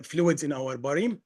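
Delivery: ordinary talk, nothing marked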